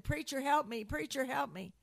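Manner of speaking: acted-out call for help; urgent plea. Retold desperate plea to preacher.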